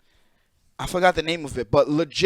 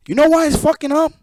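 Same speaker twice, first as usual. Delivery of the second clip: faster, even higher, pushing on "know"; heated question repeated faster; 7 semitones higher, 4 dB louder, faster than usual. Real, not acted. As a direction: hammer the question home, fast and loud. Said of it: "repeated with more force".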